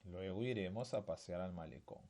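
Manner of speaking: low-key full repetition; quiet read-back. Shown as a demonstration. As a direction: read it back softly in one go. Teacher rereads the whole sentence.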